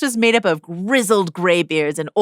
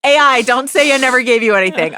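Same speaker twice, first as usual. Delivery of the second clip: ordinary delivery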